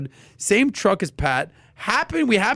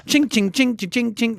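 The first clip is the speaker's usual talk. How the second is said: high, rhythmic "ching" noises; imitated cutting sound; 6 semitones higher than usual. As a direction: imitate cutlery chinking on a plate. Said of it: "like, cutting something".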